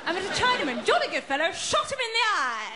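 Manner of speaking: mock colonial British officer, rising in pitch; hammed-up colonial old boy; colonial type. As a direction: parody an old colonial chap telling his exploits. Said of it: "that sounds too colonial".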